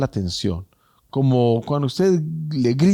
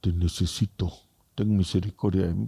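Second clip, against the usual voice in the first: much softer, lower murmured plea; under the breath; 5 semitones lower, 5 dB quieter, faster than usual. Retold or imitated. A proud person who cannot ask God, murmured plea. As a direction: barely dare to say it aloud. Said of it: "ni siquiera entre dientes".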